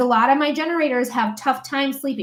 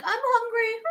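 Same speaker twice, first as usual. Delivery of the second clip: much higher squeaky pet voice, softer; dog voice; 11 semitones higher, 5 dB quieter than usual. Acted. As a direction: voice the dog begging for food. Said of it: "a dog coming up to you in your house being like".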